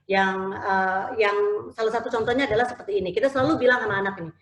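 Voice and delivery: normal talking voice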